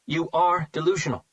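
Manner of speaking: a touch of anger, blurted; blurted retort. A narrator's reading. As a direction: snap it out without thinking. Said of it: "she replied without thinking".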